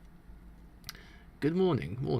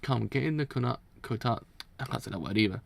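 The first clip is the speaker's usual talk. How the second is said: ordinary talk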